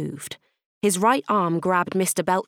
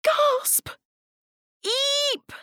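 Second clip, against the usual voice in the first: very high, tiny startled exclamations; comic high-pitched alarm; shocked squeaks. Acted. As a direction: squeak the reaction words very high. Pupils react in amazement.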